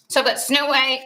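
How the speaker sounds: normal talking voice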